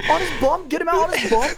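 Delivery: hammed-up high British referee accent; British ref accent. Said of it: "It's just like that".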